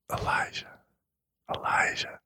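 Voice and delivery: breathy calling whisper. Retold or imitated. God gently calls Elijah in a soft whisper. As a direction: call his name in a hush. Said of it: "he has that voice that comes out".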